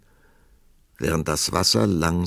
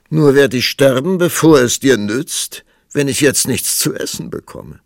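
prince pleading; 7 semitones higher, 10 dB louder than usual. Acted. Weak prince pleads for food.

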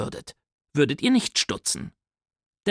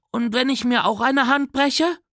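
put-on much higher, hopeful child's voice; little brother; 9 semitones higher than usual. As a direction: ask it like an eager five-year-old.

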